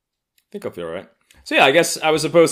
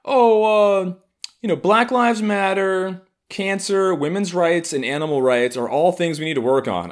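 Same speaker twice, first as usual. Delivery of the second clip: mock earnest activist; 12 semitones higher, 5 dB louder than usual. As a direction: parody a preachy activist listing causes.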